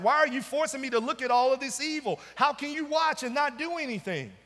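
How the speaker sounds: exasperated preacher pleading as the prophet; voiced complaint to God. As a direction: fire off the prophet's protest. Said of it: "brings his complaint to God".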